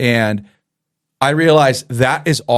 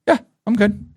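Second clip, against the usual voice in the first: higher, offhand student voice; 8 semitones higher than usual. Retold or imitated. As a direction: toss it off like a kid barely looking up. Student voiced in retold story.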